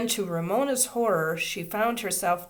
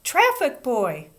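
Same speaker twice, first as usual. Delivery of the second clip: louder, higher call; 6 semitones higher, 7 dB louder, slower than usual. A narrator's reading. Teacher calling a traffic boy again, loud call. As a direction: call him over firmly. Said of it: "Miss Binney called out".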